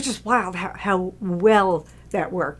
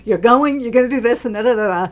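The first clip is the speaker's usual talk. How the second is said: bossy retort; 3 semitones higher, 7 dB louder than usual. Retold or imitated. John telling the director he's going, mock bossy. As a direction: brush off his complaint firmly.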